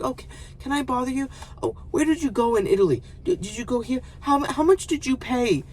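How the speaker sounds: high, quick pestering questioner; mock survey taker. Woman pestering a crying traveler with survey questions, mocking high voice. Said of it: "asking me questions about a survey".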